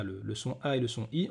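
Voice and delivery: normal talking voice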